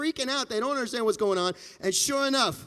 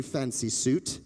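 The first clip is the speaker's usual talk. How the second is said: ordinary delivery, nothing marked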